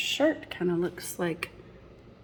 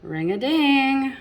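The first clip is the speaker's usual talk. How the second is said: excited chime; 7 semitones higher, 9 dB louder than usual. Acted. Reader to children at the story's peak, excited chime. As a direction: ring it out like a bell. Climax of the bell rhyme.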